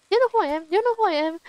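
high-pitched mock challenge, repeated; picking a fight. Imitating the lady picking a fight, confrontational. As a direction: imitate someone spoiling for a fight. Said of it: "the type who like to pick a fight".

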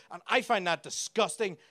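ordinary talk

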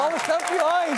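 high, slow, drawn-out victory cry; triumphant cheer. Real, not acted. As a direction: savour the championship. Celebrating Flamengo's title win. (comemorando o título, alto e arrastado)

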